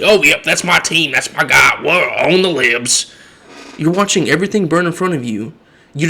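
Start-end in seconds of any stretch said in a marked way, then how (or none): 0.0-3.1 mocking dopey cheerleader; mock fan voice. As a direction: lampoon a blind team fan. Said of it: "And you're just like".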